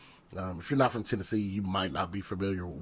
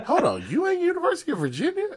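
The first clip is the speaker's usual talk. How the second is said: very high, fast, laughing disbelief; squeaky laughing "hold on"; stunned disbelief; 5 dB louder, faster than usual. Retold or imitated. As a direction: crack up as the dream collapses. Crushed realisation on the call.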